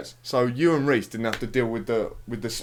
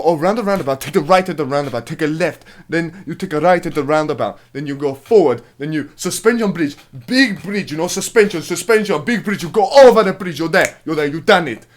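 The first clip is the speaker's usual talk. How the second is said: loud, higher hammed-up rambling directions; excited directions; 5 semitones higher, 8 dB louder than usual. Retelling the taxi guy getting really into it. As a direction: get carried away with the directions.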